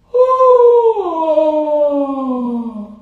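long high loud weary sigh; tired sigh. Real, not acted. Streamer telling viewers she is tired, heavy sigh. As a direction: let out an exhausted groan-sigh. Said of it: "Yeah, I'm tired".

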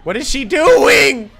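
loud, high, drawn-out yell of disbelief; exasperated shout. Real, not acted. Player at a dodging game boss, exasperated shout. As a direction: yell it, stretching the last word.

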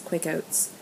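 normal talking voice

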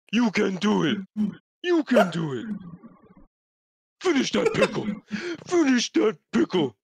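lightly voiced goofy Patrick cheer; a hint of a cartoon starfish rooting for him. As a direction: egg him on in a put-on cartoon voice.

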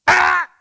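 very high, very loud sharp "Ah!"; corrective ah. Shown as a demonstration. Trainer demonstrating the correction for a barking puppy, very loud. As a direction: blast a sharp correction sound. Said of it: "That negative vocalization".

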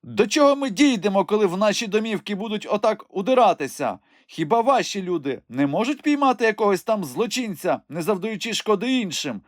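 high crone voice, threatening; cursing old crone. Acted. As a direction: curse the bishop's children. Old woman rants and curses. (висока стара, що проклинає)

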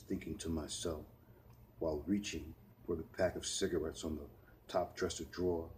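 ordinary delivery

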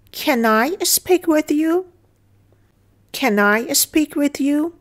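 slow model sentence. Shown as a demonstration. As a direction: spell it out slowly for learners. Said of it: "teacher models English line".

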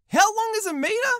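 "How long is a meter?" high, with an incredulous rise on "meter"; outraged disbelief. Pretend.

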